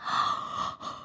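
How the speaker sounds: sharp gasp. Acted. Pigs wake up suddenly.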